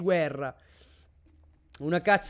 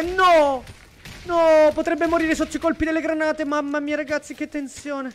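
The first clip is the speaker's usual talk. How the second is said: excited alarm; 7 semitones higher, 3 dB louder than usual. Real, not acted. Caster fears player dying.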